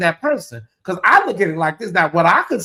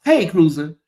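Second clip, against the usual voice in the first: ordinary talk, nothing marked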